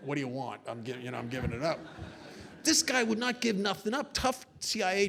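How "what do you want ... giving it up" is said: low, quiet mock surrender; half-laughing resigned mumble; caving in. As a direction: give up instantly, low and small. Acting himself giving in at once.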